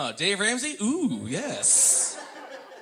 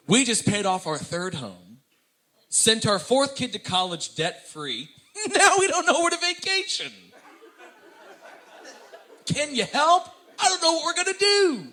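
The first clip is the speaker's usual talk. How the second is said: rich caller; 7 semitones higher than usual. Retold or imitated. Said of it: "they'll be like".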